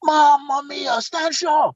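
high imitated Italian amazement; mock-astonished Italian captain; amazed police captain. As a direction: exclaim it high, like a starstruck Italian. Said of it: "he looked up at me and he went".

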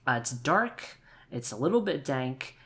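usual speaking voice

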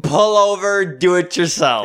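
mock outraged shout. Imagined outraged reply to friend.

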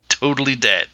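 normal talking voice

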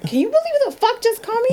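high, fast disbelief; incredulous. Retold or imitated. Telling friends about the wife's call, high rapid disbelief. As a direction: rattle it off high in total disbelief. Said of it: "I'm like".

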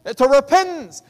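high, loud, jubilant proclamation; joyful announcement. Acted. God announcing a sinner's return, jubilant. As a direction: announce it like heaven's herald.

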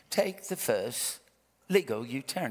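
measured British sat-nav voice; British accent. Retold or imitated. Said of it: "it's a British accent".

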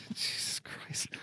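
breathy, muttered curse under his breath; exasperated whisper. Real, not acted. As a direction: whisper it to yourself, fed up. Exasperated at forgetting the sides.

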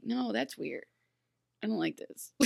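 unvoiced aside of distaste; whispered disapproval. Reacting to the scene, in a whispered disapproval. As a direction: whisper it like a quiet complaint. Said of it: "It's like".

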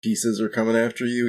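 ordinary talk, nothing marked

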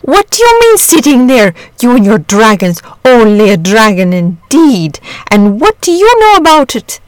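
loud, scolding father; exasperated, scornful outburst; indignant cry. A narrator's reading. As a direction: tear into the boy, pitch leaping up. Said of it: "cried his father".

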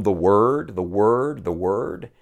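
"word" stressed in each repeat, stepping down in pitch; repeated emphasis. Shown as a demonstration. Preacher pointing out the psalm's repetition, emphasis on "word". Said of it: "see the repeated emphasis".

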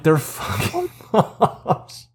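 slow, laughing disbelief; laughing exasperation. Retold or imitated. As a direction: spell it out through laughter.

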